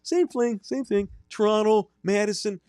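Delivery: very high, creepy Wisconsin voice; chirpy high killer voice, staying in character; Ed Gein character. Acted. Host staying in his killer character for the cohosts.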